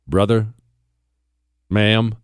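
ordinary talk, nothing marked

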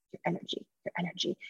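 hushed, breathy echo of the clients' praise; whispered quote. Retold or imitated. Every client whispering back that her energy helped, hushed. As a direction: whisper it like a secret everyone shares.

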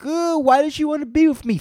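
hammed-up whiny lament; mock whiny.

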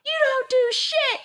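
very high-pitched accusation; retold angry jab. Wife to husband with kids standing there, retold high angry jab. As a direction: re-enact a shrieky put-down.

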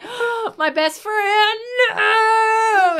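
overacted grief of a villain; hammed-up anguish. Mock-anguished villain over dead friend.

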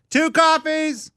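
high, drawn-out order call; yelled order. Retold or imitated. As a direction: call it out to the whole diner. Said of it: "We said".

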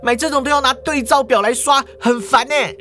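high, irritated cashier complaint; fed-up nagging, quick in the middle; displeased. Acted. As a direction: rattle off the complaint in an annoyed high voice. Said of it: "语气不高兴地说啊".

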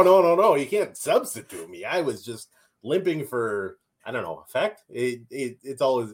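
ordinary delivery

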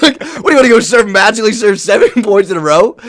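high, fast, giggling through the words; can barely get it out for laughing; laughing at the absurd ask. Real, not acted. Laughing mid-story.

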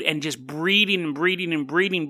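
ordinary delivery

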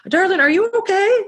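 very high put-on voice, checking in; concerned truck driver. Truck driver checks on stopped traveler, high mock concern.

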